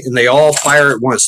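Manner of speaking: ordinary talk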